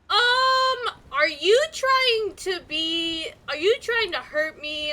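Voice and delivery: high, loud mock challenge; mock confrontation. Acting out others challenging her accent.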